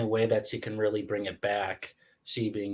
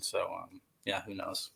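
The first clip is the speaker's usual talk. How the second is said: normal talking voice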